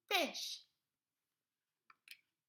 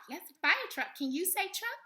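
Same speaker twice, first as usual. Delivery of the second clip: ordinary delivery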